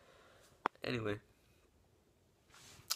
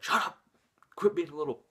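louder retold yell from the dad; imitating a harsh father shouting; mock angry dad; 4 semitones higher, 7 dB louder than usual. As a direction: bark it like a fed-up father. Dad yelling at his son.

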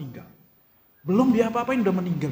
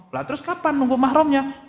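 high, louder exasperated asking; puzzled mock question; 10 semitones higher, 6 dB louder than usual. Preacher voicing the congregation's question about mahram, high puzzled exasperation. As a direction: play the baffled listener pressing for an answer.

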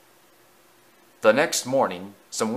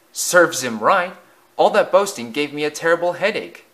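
higher, louder gloating; smirking; 5 semitones higher, 4 dB louder than usual. A narrator's reading. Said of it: "smirked the driver".

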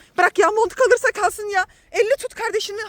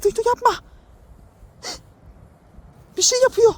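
ordinary talk, nothing marked

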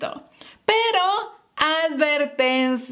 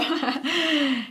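short laugh; 3 dB quieter than usual. Real, not acted. Teacher laughs at wrong example.

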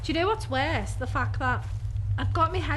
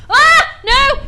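high, loud startled shriek; real fear, yelping no; terrified scream; 8 semitones higher, 13 dB louder than usual. Real, not acted. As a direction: scream as the static hits. Static means he's near.

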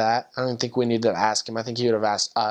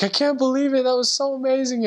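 imitating a companion's high-pitched amazement; amazed disbelief; 12 semitones higher than usual. Companion replying about the sleeping man, amazed. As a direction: marvel in a high, put-on voice.

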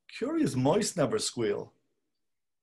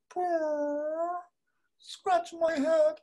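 high cat voice, slow on the last words; much slower than usual. Acted. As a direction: talk for the cat, coaxing.

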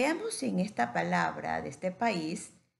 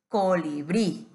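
COLIBRÍ with more force on final BRÍ; stress on last I; 3 semitones lower, 5 dB louder, slower than usual. Shown as a demonstration. Teacher explaining the tilde, COLIBRÍ stressed on BRÍ. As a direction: punch the accented last I of COLIBRÍ. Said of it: "tiene mayor fuerza de voz".

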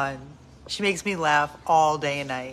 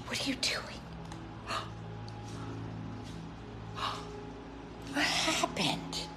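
soft, voiceless mock astonishment; whispered surprise; 6 semitones higher, 12 dB quieter than usual. Real, not acted. Owner whispering in mock surprise at her dog. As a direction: whisper it like you caught her.

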